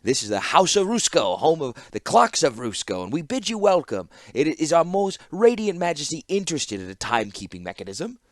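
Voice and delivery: high voiced grovelling clockmaker; pompous fawning shopkeeper. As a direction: announce the shop like a proud herald. Clockmaker voice held.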